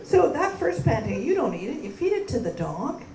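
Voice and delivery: ordinary talk, nothing marked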